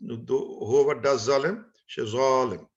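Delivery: ordinary talk, nothing marked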